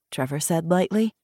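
plain reading voice

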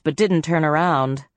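plain reading voice

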